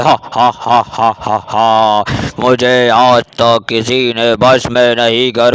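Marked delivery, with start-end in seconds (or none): 0.0-2.0 witch cackle. Acted.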